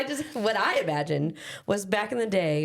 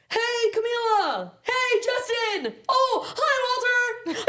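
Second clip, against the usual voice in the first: put-on excited kid greetings; slower than usual. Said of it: "and he's like".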